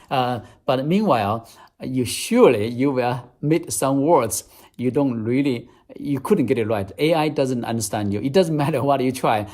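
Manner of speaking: ordinary talk, nothing marked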